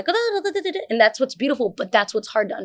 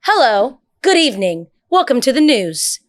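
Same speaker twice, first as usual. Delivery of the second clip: higher, louder polished news anchor read; newscaster read; 4 semitones higher, 6 dB louder than usual. Shown as a demonstration. Showing friends how a newscaster talks, news anchor read.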